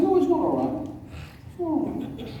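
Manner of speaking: high-pitched, insistent retort; heated objection. Retold or imitated. Fifteen-year-old refusing the pastor's excuse, heated objection. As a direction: push back hard, pitched well up. Teenager argues back at pastor.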